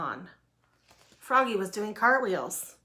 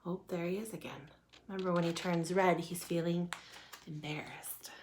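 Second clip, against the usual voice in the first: soft, quick aside fading to a whisper; hushed aside; 3 semitones lower, 8 dB quieter, faster than usual. A narrator's reading. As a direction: confide it softly to young listeners.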